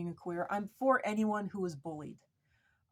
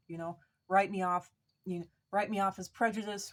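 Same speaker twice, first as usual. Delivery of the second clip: usual speaking voice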